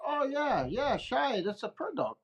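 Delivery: high, soft imitated Navajo accent; mock Navajo accent, falling away softly; heavy Navajo accent. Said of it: "he's had his heavy Navajo accent".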